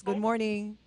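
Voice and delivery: distant, off-mic voice; sounding far from the phone; phone held away. Real, not acted. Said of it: "she's listening on the net, you have to lower".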